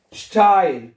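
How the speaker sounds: स्टाइल as "shtail", opening with "sht"; EI read as "ai". Shown as a demonstration. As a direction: sound the vowel of स्टाइल like English "eye". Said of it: "ईआई को आई पढ़ना है". (स्टाइल, ईआई को आई पढ़ते हुए)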